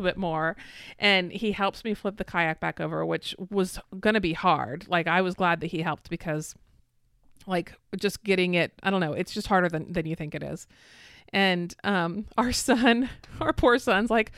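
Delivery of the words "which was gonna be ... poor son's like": ordinary delivery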